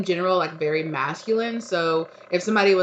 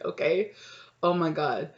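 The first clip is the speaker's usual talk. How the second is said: ordinary talk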